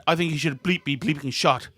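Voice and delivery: rough mock English accent with an Irish lilt; imitated English accent. Host imitates a school friend back from Oxford, mock English accent. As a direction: put on a shaky attempt at an English accent. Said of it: "in her English accent".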